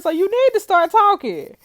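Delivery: high, loud command; stern demand. Acted. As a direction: order her to talk. Detective demanding she talk.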